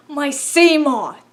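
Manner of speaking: loud, drawn-out lament; mock anguish. Player reacting to Reaper biting the Seamoth, hammed-up anguish. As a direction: wail slowly over a beloved loss. Acting out dismay at wrecked vehicle.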